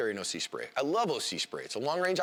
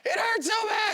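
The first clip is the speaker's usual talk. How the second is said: very high, loud pained wail; 5 dB louder, slower than usual. Retold or imitated. Sprayed suspect crying to the officer, high pained wail. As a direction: cry out in agony.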